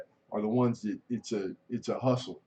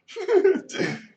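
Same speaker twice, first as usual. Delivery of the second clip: a sudden high-pitched squeal of a laugh; 8 dB louder than usual. Real, not acted. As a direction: let out a high, loud yelp of laughter. Bursts out after "I'm scared.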